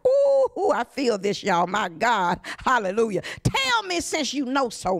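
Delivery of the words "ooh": high loud ooh of rapture; sudden high cry of praise; ecstatic whoop. Real, not acted. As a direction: let the spirit pull a whoop out. Preacher overcome with fervour.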